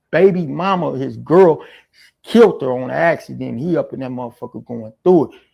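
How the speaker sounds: ordinary delivery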